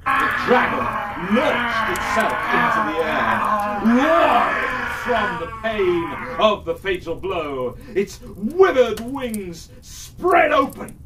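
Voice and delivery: high and loud, shouting the killing blow; climactic loud narration. A narrator's reading. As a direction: build the fatal strike to a shout. Sword strikes the dragon.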